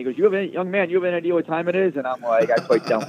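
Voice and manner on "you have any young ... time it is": put-on high, fast irritated old boss voice; mock scolding, pitched well up; imitating George Steinbrenner. Retelling Steinbrenner scolding him for the late call, high and fast.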